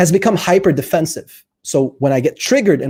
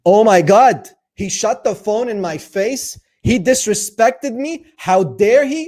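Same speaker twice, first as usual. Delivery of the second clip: high, louder mock outrage, slowing down; outraged partner; 6 semitones higher, 3 dB louder, slower than usual. Partner outraged after he shut the phone, mock indignant. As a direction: play the offended partner, hammed up. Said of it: "not a very mature communicator".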